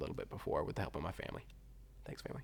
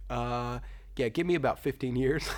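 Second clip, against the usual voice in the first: joking father reply; 6 semitones higher, 8 dB louder than usual. Retold or imitated. Father jokingly answering the request.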